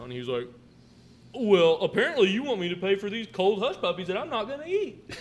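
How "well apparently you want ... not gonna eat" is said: high, loud mimicry of an indignant customer; mock pissed off. Customer refusing to pay for cold hush puppies, mock pissed off. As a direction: imitate an affronted complainer, pitched way up. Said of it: "he's all pissed off".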